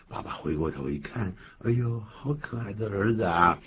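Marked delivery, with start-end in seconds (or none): none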